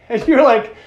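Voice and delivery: usual speaking voice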